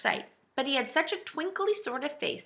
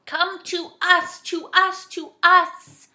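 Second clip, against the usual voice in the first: high, loud, slow, drawn-out call; despairing shout; 9 semitones higher, 8 dB louder, much slower than usual. A narrator's reading. Joe corrects the saucepan man, shouting in despair. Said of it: "shouted Joe in despair".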